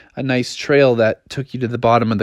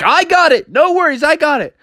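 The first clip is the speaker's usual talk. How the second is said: high and breezy, no worries; high confident cub voice; 12 semitones higher than usual. Acted. Bubby reassures.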